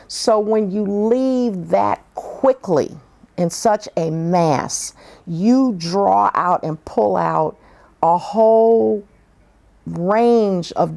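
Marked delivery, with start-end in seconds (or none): none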